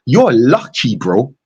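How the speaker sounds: ordinary delivery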